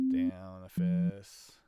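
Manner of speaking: usual speaking voice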